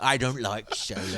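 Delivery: quick attempt at an Irish accent. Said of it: "Irish accent".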